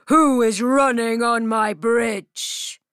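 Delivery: booming troll challenge. Acted. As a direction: challenge the goat like a bridge troll. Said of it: "the troll says to the goat".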